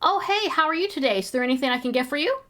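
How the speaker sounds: high, chirpy mock-friendly cashier; overly sweet customer-service voice; cashier greeting. Mimicking the cashier greeting the guy, high and sugary. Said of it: "peeks up and goes".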